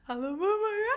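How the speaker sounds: high-pitched mock phone hello; imitated emotional "hello, mum"; retold emotional greeting. Acts her startled answer on phone.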